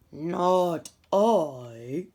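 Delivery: a sleepy dog grumbling no; sleepy dog refusing. Acted.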